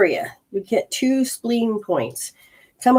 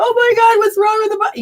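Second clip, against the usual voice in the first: panicked outcry; 5 dB louder, faster than usual. Pretend. Voicing an anxious person's panic.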